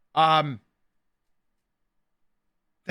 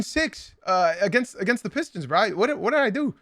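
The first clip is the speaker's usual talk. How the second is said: pleading with the chat, higher and baffled; exasperated disbelief; 5 semitones higher than usual. Real, not acted. Streamer baffled by TikTok comments, pleading exasperated disbelief. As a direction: ask what you did wrong, honestly bewildered.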